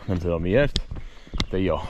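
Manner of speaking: ordinary talk, nothing marked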